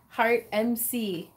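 ordinary delivery

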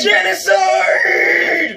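high, loud, long-stretched shout; wild drawn-out yell. Real, not acted. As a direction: scream it slowly in manic triumph. Excited yell during kills.

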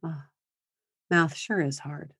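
soft, gentle sympathy; quiet, caring parent voice; empathetic parent. Shown as a demonstration. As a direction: soothe the kid over homework. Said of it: "So we wanna just say".

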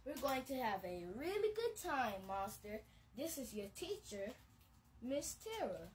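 put-on storybook voice for kids; bedtime-story narration; reading to children. A narrator's reading. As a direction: read it like a picture book to little ones. Reads picture book aloud.

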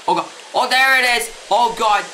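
high panicked gasps of fright; startled scream. Real, not acted. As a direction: jump out of your skin, shouting. Sees Siren Head, startled.

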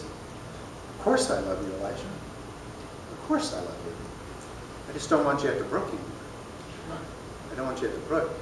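put-on calm a worried child; God soothing Elijah quietly; gentle God voice.

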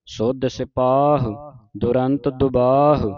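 ਸਿਪਾਹ and ਦੁਬਾਹ with a stretched aa; long kanna. Shown as a demonstration. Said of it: "ਕੰਨਾ ਲੰਮਾ ਕਰਕੇ ਉਚਾਰਨ ਕਰਨਾ ਹੈ".

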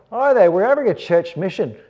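loud, high-pitched Australian caller; hammed-up Aussie on the phone; Australian accent. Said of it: "Love my Australian accent".

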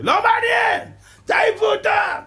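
high furious shout of a ship's captain; angry captain. Acted. Captain yelling at the stokers to throw out the coal, high furious shout. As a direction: bellow the orders like an outraged captain.

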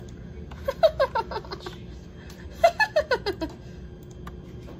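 high cackling witch laugh. Pretend. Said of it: "Gotta work on my witch laugh".

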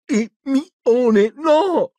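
high, strained, broken cry; garbled through a gag. A narrator's reading. Ron begging Hermione through his gag, strained garbled cry. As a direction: cry out as if gagged. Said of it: "biascicò Ron attraverso il bavaglio".

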